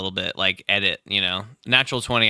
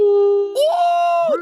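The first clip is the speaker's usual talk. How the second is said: long, very high cheer; real high-pitched woo; excited whoop. Real, not acted. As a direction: whoop in celebration. Cheering the natural twenty.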